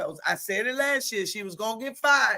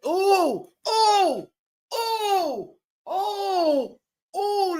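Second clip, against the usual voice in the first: rising, much higher delighted oohs; thrilled oohs; 9 semitones higher, much slower than usual. Real, not acted. Watching a spin move, thrilled oohs. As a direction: gasp with delight at the play.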